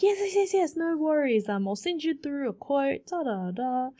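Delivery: high, eager yes-yes-yes; eager phone reply. Retold or imitated. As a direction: sound keen and agreeable. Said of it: "I'm like".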